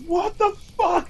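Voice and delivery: high, drawn-out startled shriek, imitated; startled scream. Startled woman in the theater, imitated shriek. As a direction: mimic her jumping out of her seat, high and stretched. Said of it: "did she not jump straight up out of her seat".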